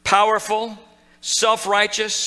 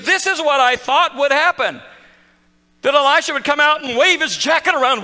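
higher, louder mocking indignation; self-righteous; 9 semitones higher, 6 dB louder than usual. Preacher mocking proud Naaman's expectations, higher and louder. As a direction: lampoon a man used to a show. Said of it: "somebody who is self-righteous".

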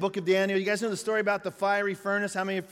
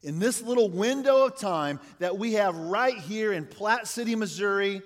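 ordinary talk

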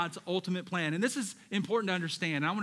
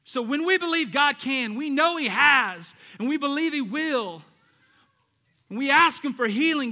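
preaching; 6 semitones higher, 9 dB louder than usual. Real, not acted. Preaching at full force.

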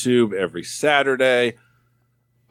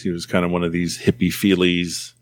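normal talking voice

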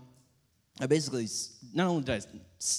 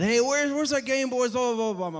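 put-on much higher, frantic kid voice; panicked little brothers; 10 semitones higher than usual. Little brothers panicking over lost Game Boys, high pitched.

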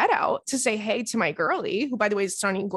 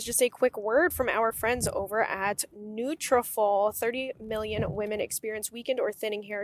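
ad read; 5 dB quieter than usual. Acted. Ad read begins.

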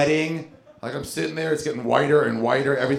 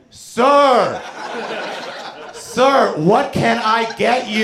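much higher and louder, slow "sir, sir"; counter guy; 9 semitones higher, 3 dB louder, much slower than usual. Retold or imitated. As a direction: roep hem hoog en luid aan. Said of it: "a guy behind the concession stand just going".

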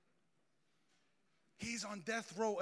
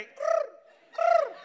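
high squeaky cricket noise; cricket chirp; 11 dB louder than usual. Retold or imitated. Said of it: "I heard the cricket say".

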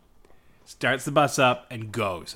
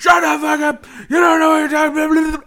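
high, loud mock scream; hammed-up furious shouting; imitated raging bus driver; 8 dB louder than usual. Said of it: "he's, like, swearing and muttering to himself".

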